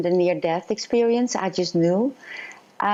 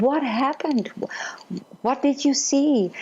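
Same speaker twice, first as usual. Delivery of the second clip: much higher, retold urgent question; imitated eager questioning; 7 semitones higher than usual. Nurse at patient's bedside asking about the vision, imitated eager questioning. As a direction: act out a nurse pressing for answers, pitched up.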